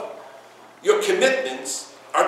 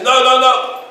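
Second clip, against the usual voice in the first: loud protest; 6 semitones higher, 7 dB louder, slower than usual. Retold or imitated. Young man pleading loudly.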